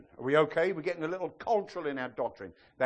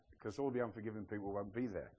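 softer, lower, quick punchline; sly aside; 8 semitones lower, 12 dB quieter, faster than usual. Real, not acted. Pastor slips a joke to the church, sly aside. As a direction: slip the joke in quietly.

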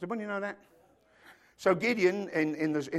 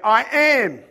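proclaiming; 4 semitones higher, 10 dB louder than usual. Acted. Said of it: "John the Baptist stood there and he said".

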